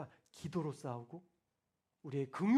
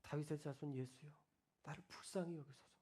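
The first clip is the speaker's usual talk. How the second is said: hushed, lower pleading prayer; friend's plea; 5 semitones lower, 14 dB quieter than usual. Retold or imitated. Retelling a struggling friend's prayer, hushed and pleading. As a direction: quietly beg for mercy. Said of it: "이 친구가 했던 기도가".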